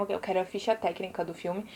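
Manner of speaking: ordinary delivery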